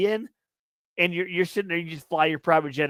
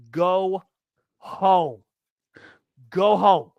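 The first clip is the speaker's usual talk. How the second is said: louder barked order to leave; angry yell; 2 semitones higher, 4 dB louder, much slower than usual. Retold or imitated. Podcaster ranting at a rude fan, loud angry order. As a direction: tell him off, loud and final. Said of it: "let me go on a little rant here".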